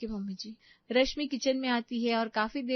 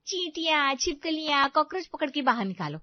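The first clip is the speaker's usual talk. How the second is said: higher-pitched sarcastic complaint; grumble, mimicking the bossy mother-in-law; mocking grumble; 5 semitones higher, 3 dB louder than usual. बहू सास की नकल में कुढ़ती है. (चिढ़कर ताना मारते हुए)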